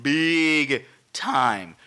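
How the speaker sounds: BIG drawn out long, then TIME; slow, weighty "Big time"; emphatic drawl. Real, not acted. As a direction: stretch out "Big time" word by word.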